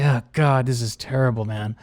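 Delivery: low, soft, sinking groan of defeat; quiet mock despair; dejected mutter. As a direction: mutter it like the night is ruined. Dejected aside after friends' quote.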